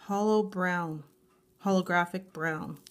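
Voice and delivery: ordinary talk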